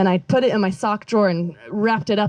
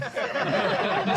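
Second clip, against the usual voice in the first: short airy laugh; breathy laugh; 7 dB quieter than usual. Real, not acted. Joking about a yellow thong, airy laugh. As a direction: let out a quick breathy chuckle.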